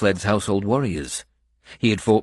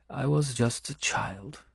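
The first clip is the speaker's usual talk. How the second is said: Benedetta's voice; 7 semitones higher, 5 dB quieter than usual. Acted. Benedetta voiced, sad memory.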